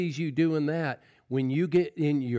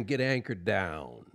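ordinary delivery

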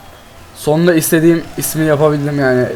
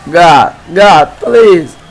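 pleading shout; 3 semitones higher, 7 dB louder, much slower than usual. Real, not acted. Begging for a goal.

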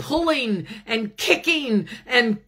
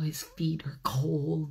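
whisper; 5 semitones lower, 7 dB quieter than usual. A narrator's reading. Hushed storytelling.

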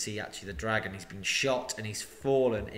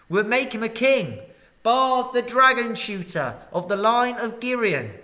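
lamenting crowd; 9 semitones higher, 7 dB louder than usual. Acted. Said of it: "they all said".

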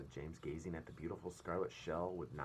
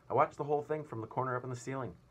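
usual speaking voice

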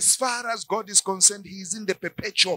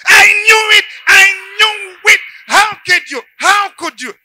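screamed, much higher and louder accusation; outraged wife; 10 semitones higher, 16 dB louder than usual. Acted. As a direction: explode at the betrayal. Wife explodes after confession.

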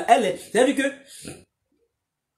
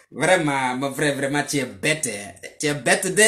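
lower, disdainful head-shaking tone; scornful pity; 6 semitones lower than usual. Real, not acted. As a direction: pity him with contempt, lower. Scornful aside to the brother. (mépris apitoyé, voix plus basse)

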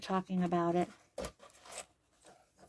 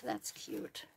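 soft whisper to herself; 12 dB quieter than usual. Real, not acted. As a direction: breathe it out as a whisper. Admiring the lace to herself.

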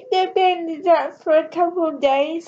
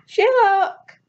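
a sharp louder call of his name; 5 semitones higher, 4 dB louder than usual. Acted. Sarah calling Sherlock who ignores her, insistent call. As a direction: call him again, insisting.

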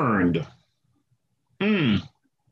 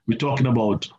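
ordinary delivery

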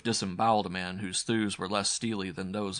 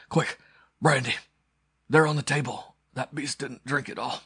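raised, strained, pained command; higher, louder plea through agony; urgent demand; 6 semitones higher, 5 dB louder than usual. A narrator's reading. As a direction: call out desperately for relief. Wounded man urgently demands brandy.

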